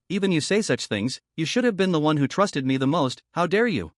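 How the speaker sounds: ordinary delivery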